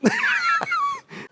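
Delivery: squealing falsetto laugh, mocking a mate; Al's laugh. Teasing his mate Al by copying his laugh, high squeal. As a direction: send up your mate's laugh in a squeaky shriek. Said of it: "let me do an impression of Al".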